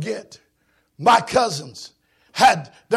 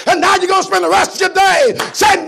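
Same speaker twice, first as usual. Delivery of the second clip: preaching at full force; 10 semitones higher, 10 dB louder than usual. Acted. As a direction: hammer the regret home. Preaching climax, repeated lament.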